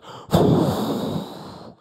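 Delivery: loud rushing whoosh made with breath; big voiceless whoosh; imitated whoosh. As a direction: imitate the tunnel suddenly lighting up.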